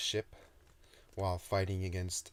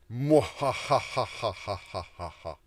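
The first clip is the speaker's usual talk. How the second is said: demon laugh; 4 semitones higher, 10 dB louder than usual. Shown as a demonstration. Author redoes the demon laugh, loud evil cackle. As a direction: ham up an evil mwahaha. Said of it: "that was a very bad demon laugh".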